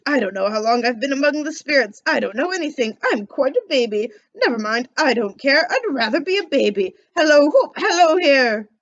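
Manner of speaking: put-on old Scrooge, loud and giddy with joy. Scrooge calling out in delight on Christmas morning. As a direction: bounce the words out like a thrilled old man.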